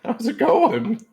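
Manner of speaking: high, loud granny voice; Grammy voice. Acted. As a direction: play a sweet old granny, high and bright. [name] still voicing Grammy.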